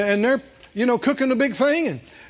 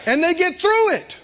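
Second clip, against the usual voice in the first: rising preaching; 7 semitones higher than usual. Real, not acted.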